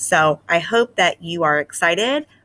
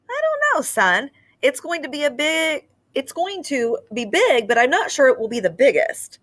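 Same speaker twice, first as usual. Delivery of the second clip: much higher hesitant fatherly voice; doubtful dad; 8 semitones higher than usual. Acted. Dad doubting his son's snowman, hesitant. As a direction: muse doubtfully over the snowman. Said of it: "Dad scratched his head".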